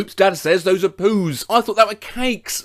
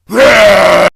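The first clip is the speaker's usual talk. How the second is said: loud burst of laughter; 14 dB louder than usual. Real, not acted. Loud laugh after shout.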